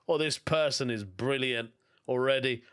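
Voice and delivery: ordinary delivery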